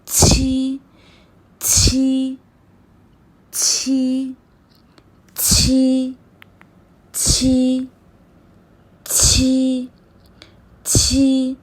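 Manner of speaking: छी with air pushed through joined teeth; pinyin q sound. Shown as a demonstration. Teacher drilling pinyin q, airy छी. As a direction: breathe out hard on छी. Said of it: "मुंह से हमें air जो है वो पास करनी है".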